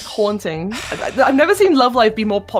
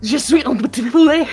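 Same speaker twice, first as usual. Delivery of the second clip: put-on French accent; 5 semitones higher than usual. Joking French phrase.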